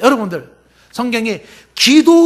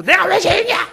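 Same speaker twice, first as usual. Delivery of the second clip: retold angry yell; 8 semitones higher than usual. Coworker protests being called a sinner, mock yell. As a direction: imitate someone yelling in protest. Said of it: "막 소리를 질렀다는 거 아니에요?".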